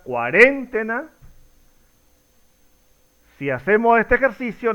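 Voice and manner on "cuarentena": "cuarentena" stressed on REN, cuaRÉNtena; questioning "cuarentena" accented on the second syllable, REN; misplaced stress on REN. Shown as a demonstration. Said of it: "Es la pronunciación correcta".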